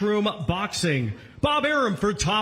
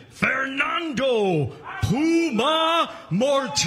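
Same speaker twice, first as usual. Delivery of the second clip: boxing announcer callout; 6 semitones higher than usual. Real, not acted. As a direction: call the name out to the arena. MC calls out the champion.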